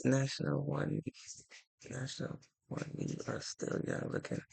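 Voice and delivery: very soft, trailing read-aloud mumble; reading under breath. Real, not acted. Reading viewers' comments on a livestream, under breath. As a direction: murmur it half to yourself, barely voiced.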